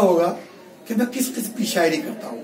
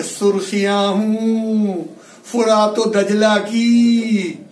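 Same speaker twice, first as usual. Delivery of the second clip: slow, ringing mushaira declamation; poetry recitation; 3 dB louder, much slower than usual. Acted. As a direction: draw out the line endings with weight. Recites the next couplet.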